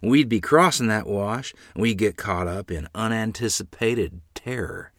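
ordinary delivery

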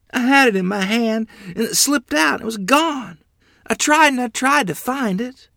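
put-on high, loud, distraught child; desperate pleading. Child explains lost dollar to angry mother, desperate. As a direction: plead your case in a panic.

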